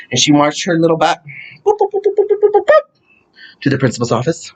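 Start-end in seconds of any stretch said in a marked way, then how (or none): 1.7-2.8 high bouncy boops; high playful footstep noises; mock marching boops. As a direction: bop along like angry little steps. Marching footsteps imitated vocally.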